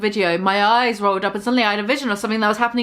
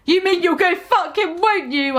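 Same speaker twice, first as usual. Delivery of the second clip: high, accusing mock shout; mock angry shout; 7 semitones higher than usual. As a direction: accuse him, pitched high. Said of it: "I shouted angrily".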